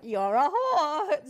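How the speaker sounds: high, loud, slow mock drunken jab; playful drunken insult. Friends teasing each other, loud laughing mock insult. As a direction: toss it with a laugh like an old stage joke. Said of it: "we still, like, call each other".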